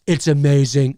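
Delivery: cartoonish boasting caricature; one emphatic boast in a politician's caricature; Donald Trump. Shown as a demonstration. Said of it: "cartoon Trump and you hear me going".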